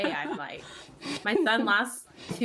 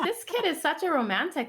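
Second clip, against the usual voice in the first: delighted gush; 9 semitones higher than usual. Retold or imitated. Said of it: "I'm just like".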